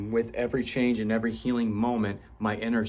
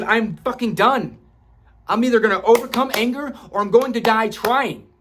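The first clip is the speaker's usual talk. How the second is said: higher, louder vow, fierce resolve; determined declaration; 11 semitones higher, 8 dB louder than usual. Retold or imitated. Speaker recalling his breaking point: loud, higher, fierce vow. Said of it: "the moment when I said".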